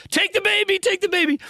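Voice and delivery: very high, anguished mock shriek; Sophie's Choice scream. Woody begging to take the baby away, very high anguished mock shriek. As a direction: wail it like a tragic sacrifice. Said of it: "He starts screaming like he's having a full Sophie's Choice".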